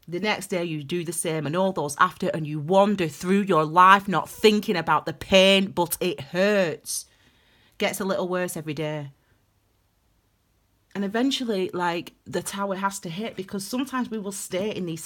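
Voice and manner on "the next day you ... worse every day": poem recital. Shown as a demonstration. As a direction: recite it low, stretching the painful words. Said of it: "I'm gonna share that poem".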